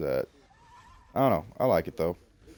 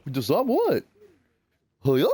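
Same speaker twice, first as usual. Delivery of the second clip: much higher, louder cartoon Goofy voice; Goofy impression; 8 semitones higher, 8 dB louder than usual. Dad teasing his daughter with a goofy Goofy voice. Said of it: "if it actually sounds like Goofy".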